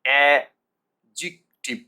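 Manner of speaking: slow, loud a... jik... tiv for অ্যাডজেক্টিভ; three separated beats in অ্যাডজেক্টিভ, no d; silent d. Shown as a demonstration. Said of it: "ডিটা উচ্চারণ আসবে না".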